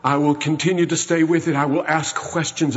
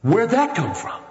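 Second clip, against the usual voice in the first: pitched up in sudden wonder; astonished; 6 semitones higher than usual. Acted. Preacher marvels at the dying thief, high and amazed. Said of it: "preacher voices astonishment".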